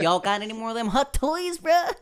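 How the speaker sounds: high laughing Dave Chappelle [name]. Retold or imitated. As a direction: ask it like a craving addict. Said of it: "[name]".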